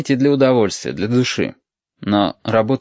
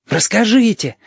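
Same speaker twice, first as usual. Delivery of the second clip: put-on excited kid begging, pitched up; eager demand; 11 semitones higher than usual. A narrator's reading. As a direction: call out eagerly for the story. Children all demand the story.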